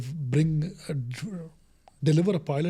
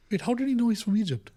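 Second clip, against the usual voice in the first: puzzled surprise; 8 semitones higher than usual. Retold or imitated. Team's puzzled reaction retold.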